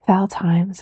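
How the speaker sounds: ordinary delivery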